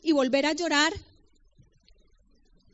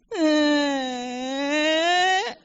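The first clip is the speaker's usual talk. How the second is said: laugh; 3 semitones higher, 5 dB louder than usual. Real, not acted. Emotional laugh after friend's call.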